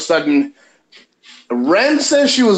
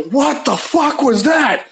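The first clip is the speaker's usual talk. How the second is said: shocked; 9 semitones higher than usual. Retold or imitated. Shocked by the deep voice.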